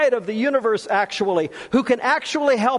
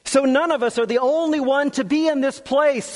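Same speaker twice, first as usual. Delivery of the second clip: ordinary talk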